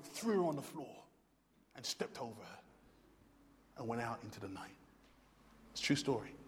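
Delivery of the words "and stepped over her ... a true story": normal talking voice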